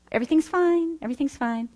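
high, lilting mock-cheerful denial; pretending all is fine. Speaker imitating own past pretense after wounding, falsely fine. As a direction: put on a high little "all is well" voice. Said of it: "rejection protection is pretense".

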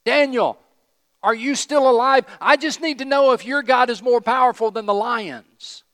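high, hammed-up desperate yell; anguished shout. King shouting into the den for Daniel, anguished. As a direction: shout down to someone who may be dead. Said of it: "called out to Daniel in an anguished voice".

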